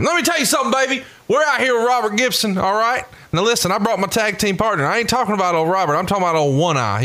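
high wrestling manager voice; Teddy Long. Pretend. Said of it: "Teddy Long".

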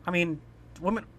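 ordinary delivery

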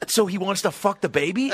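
high-pitched appalled question; incredulous repeat. Retold or imitated. Moviegoer pressing friend after her explanation, incredulous. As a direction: push the question up in mock disbelief.

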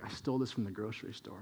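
put-on quick, hushed kid boast; kid bragging. Playground kid bragging to young Caleb about stealing, quick hushed boast. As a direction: rush it out softly, like a secret. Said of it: "and it's like".

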